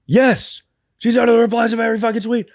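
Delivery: very high-pitched, rapid excited squeal. Real, not acted. Podcaster confirming to co-host she's under every tweet, high excited squeal.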